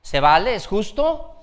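ordinary talk

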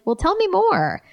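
put-on mother gently prompting a child to go on; gentle coaxing. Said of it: "I was like".

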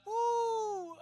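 high fake whoop; a brave-face hooray; a strained pretend celebration; forced cheer. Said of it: "putting on a brave face and we're going like".

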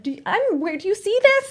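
very high, prissy Clueless-style whine; valley girl protest. Retold or imitated. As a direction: play a Clueless-style diva. Retold protest to police.

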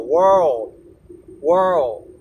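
"World" gliding from r into l; smooth English world. Shown as a demonstration. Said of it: "滑らかに舌が前歯の後ろまで行く". (舌を滑らかに前歯の裏へ運んで「World」)

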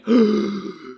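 loud sudden gasp; sharp gasp of realization. Retold or imitated. He realizes who the fan is, gasping. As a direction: gasp as it all clicks.

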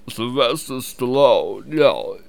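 slow, slurred action-star impression; slurry tough-guy movie star, hammed up; Sylvester Stallone. Shown as a demonstration. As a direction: drag the words out with swooping pitch. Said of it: "people that I, like, attempt to impersonate".

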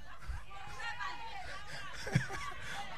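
a long high chuckle; high breathy laugh. Real, not acted. Preacher laughing at his school memory, high and soft.

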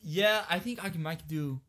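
normal talking voice

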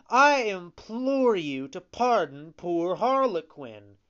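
high, loud plea; imploring. A narrator's reading. Pinocchio imploring the showman to pardon Harlequin, high pleading.

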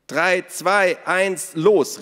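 high, loud countdown call; counting down loud. Acted. The twins start the juggling match, calling out. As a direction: shout the count. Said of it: "riefen Ada und Naru".

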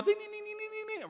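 very high sung ding ding; bell imitation. Recalling the school fire drill bell, very high pitched.

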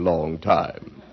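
ordinary talk

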